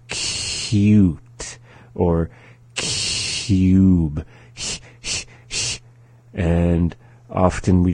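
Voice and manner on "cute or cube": CUTE and CUBE very slow, hissy breath held after the K; held first sound. Shown as a demonstration. Teacher showing how to approach the sound, held first sound. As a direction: stretch the hiss at the start of CUTE and CUBE. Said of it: "saying it very slowly, holding that first sound".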